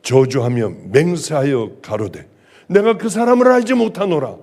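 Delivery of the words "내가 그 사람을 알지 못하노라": a firm, louder denial; strong tone. A narrator's reading. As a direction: deny him forcefully, raising the voice. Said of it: "강한 어조로".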